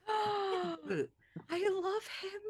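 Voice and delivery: high gasp into adoring squeal; squealing delight. Real, not acted. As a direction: melt over something cute. Gasps at the pet's squeak.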